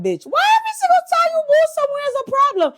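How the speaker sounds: high, fast mock-exasperated squeal; mock-outraged high quick voice; exasperated viewer. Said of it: "Y'all probably like".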